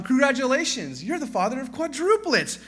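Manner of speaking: nurse announcing. Acted. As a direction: announce the big news like a beaming nurse. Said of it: "the next nurse comes up to the third guy".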